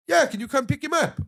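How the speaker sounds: imitated officer, pitched well up; police sergeant on phone. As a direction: repeat the request in a high sergeant voice. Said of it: "it's Detective Staff Sergeant".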